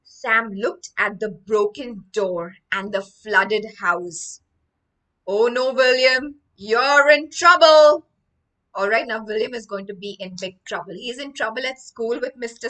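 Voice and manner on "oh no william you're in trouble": warning. Acted. Sam warns William.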